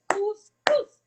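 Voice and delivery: "Couscous" split into two loud beats, cous-cous; clapped syllables. Shown as a demonstration. Teacher shows a child how to clap "Couscous" in syllables. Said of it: "Tape-moi couscous".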